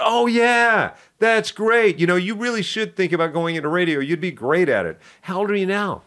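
a lively DJ who is pleased to hear it; excited radio man. Retold or imitated.

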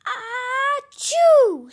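loud high hammed-up achoo; big sneeze. A narrator's reading. Teen sneezing snot toward his brother. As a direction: let a huge sneeze burst out. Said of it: "sneezed Jimmy".

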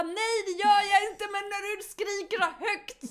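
high, loud whining protest; loud exasperated protest. Retold or imitated. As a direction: yell back at the coach, fed up. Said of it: "Man bara".

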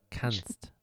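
"kannst" set apart on its own; "kannst" as a pointed one-word emphasis; stress on kannst. Shown as a demonstration. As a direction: let "kannst" stand alone, pointedly. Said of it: "Kannst ist die Betonung".

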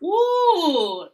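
high, loud whoop with a laugh; loud celebratory woo; excited whoop. Real, not acted. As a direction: whoop in triumph.

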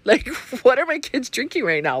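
half-laughing at her own horror; laughing mock alarm. Retelling shock at the soda.

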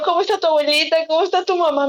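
high, tearful retold questioning; hammed-up weepy worry, pitched high; mock crying. Asking Luis about grandmother and mother, mock crying. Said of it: "yo llorando".

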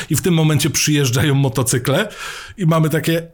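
ordinary talk, nothing marked

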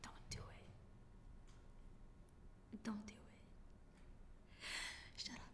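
slow hushed whisper; whispered warning. Real, not acted. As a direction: breathe it slowly as a warning. Warning listeners off.

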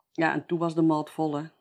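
usual speaking voice

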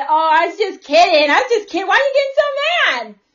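high, loud, whiny mock of a believer saying he was kidding; mock backpedaling believer. Mocking a believer who claims he was only kidding, high and loud.

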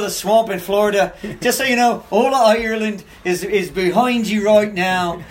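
imitating an excited Irishman's brogue; retold speech in a lilting Irish accent. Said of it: "I heard the Irish accent".

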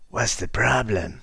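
a hint of a gravel-voiced action hero; action guy movie voice. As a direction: growl it like a tough movie cop. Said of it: "his best, like, action guy movie voice".

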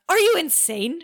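high incredulous demand. A narrator's reading. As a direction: demand it in disbelief, pitch jumping up. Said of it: "she demanded".

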